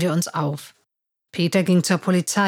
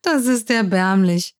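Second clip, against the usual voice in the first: laughing at Peter, then sneering; mocking laugh; 4 semitones higher than usual. A narrator's reading. Scarlett laughing at disappointed Peter, mocking laugh. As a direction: laugh scornfully through the words. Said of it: "lachte Scarlett".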